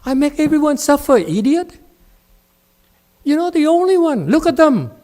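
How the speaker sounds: loud high angry yell; furious master yelling. Real, not acted. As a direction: shout the student down.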